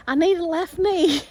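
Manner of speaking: high, loud mock-desperate demand; joking plea. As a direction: pipe up loudly with a comic retold plea. Joking retold demand for dad's knee.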